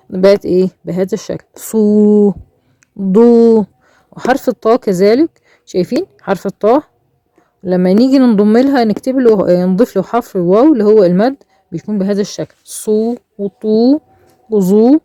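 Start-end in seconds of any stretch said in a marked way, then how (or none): 1.5-3.6 صو and ضو each held on a long oo; elongated madd. Shown as a demonstration. Teacher reading the written letters with waw, long held صو and ضو. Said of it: "لازم نمد كده شوية". 12.6-15.0 صو وطو وظو slowly, each oo held; elongated madd. Shown as a demonstration. Teacher reading waw madd off the board, slow stretched صو وطو وظو. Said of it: "لازم نمد كده شوية".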